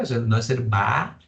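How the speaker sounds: usual speaking voice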